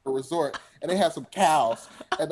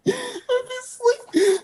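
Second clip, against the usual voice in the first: laughing high as he says it; slower than usual. Real, not acted. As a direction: giggle through the words. Laughing about falling asleep.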